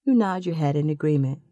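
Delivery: ordinary delivery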